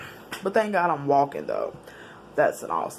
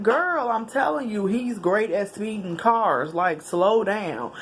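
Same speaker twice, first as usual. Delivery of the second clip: slow retold warning; 4 semitones higher, slower than usual.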